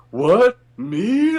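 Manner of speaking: surfer voice. Retold or imitated. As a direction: play a startled laid-back surfer. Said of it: "in his fucking surfer-ass voice".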